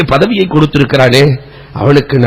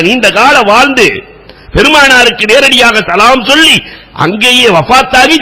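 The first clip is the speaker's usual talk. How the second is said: fervent preaching; 7 semitones higher than usual. Acted. Preacher retelling Bilal's question to the congregation, impassioned. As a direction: preach it with rising fervour.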